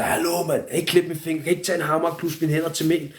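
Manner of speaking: exasperated retold plea; retold protest. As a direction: object in alarm, acting it out. Retold protest against losing finger.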